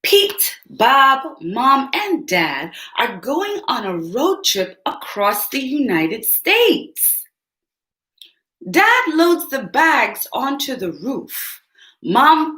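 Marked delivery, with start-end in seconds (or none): none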